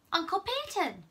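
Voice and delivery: high surprised call of Uncle Peyton,; in surprise. Acted. Charlie surprised to see his uncle appear, blurting. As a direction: blurt the name, caught off guard. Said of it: "he said in surprise".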